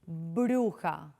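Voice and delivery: "Bruja" slow and low, B made with both lips; bilabial B. Shown as a demonstration. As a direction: press the lips together for the B in "Bruja". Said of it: "uno junta los labiecitos para pronunciarla".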